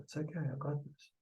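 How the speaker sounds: very soft self-comfort; hushed reassurance under your breath; soothing yourself. Shown as a demonstration. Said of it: "you just say that to yourself".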